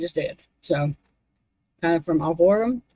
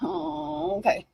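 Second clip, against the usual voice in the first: ordinary talk, nothing marked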